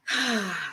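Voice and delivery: long audible sigh. Real, not acted. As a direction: breathe out in one falling sigh. Breathwork before leading the meditation.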